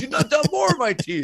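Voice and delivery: very high mock-aggrieved wail; toothless movie thug. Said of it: "He spits out more teeth. He goes".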